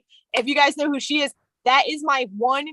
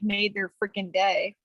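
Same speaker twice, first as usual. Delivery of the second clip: usual speaking voice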